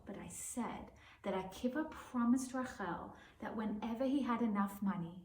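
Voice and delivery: plain reading voice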